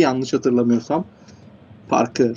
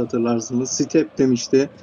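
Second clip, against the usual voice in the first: ordinary delivery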